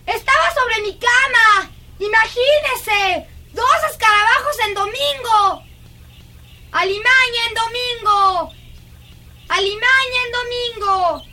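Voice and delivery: high, eager, breathless delight; excited chatter. Acted. Girl telling governess of beetle on her bed, excited. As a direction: gush about the second beetle. Child thrilled by second beetle.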